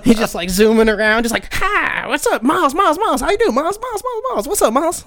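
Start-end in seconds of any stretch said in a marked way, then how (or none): 1.5-5.1 high, manic rapid-fire greeting, much higher than his own voice; a wired street guy chattering his name over and over; imitating Eddie. Homeless Eddie greeting barista Miles, high and manic. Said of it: "that's literally how he talks to me".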